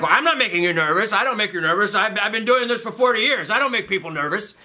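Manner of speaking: high-pitched, defensive mock outburst; imitated indignant doctor. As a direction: protest like an offended old doctor. Doctor indignantly denies, retold quarrel.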